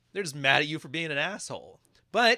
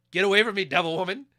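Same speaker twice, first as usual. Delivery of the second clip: mock shout; 5 semitones higher, 6 dB louder, faster than usual. Man shoos girlfriend away.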